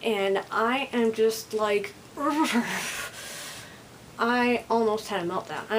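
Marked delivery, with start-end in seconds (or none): 2.1-3.6 short exasperated growl trailing into breath; frustrated growl. Retold or imitated. Vlogger retelling being nagged by mom, exasperated growl. Imitates own overload noise of frustration.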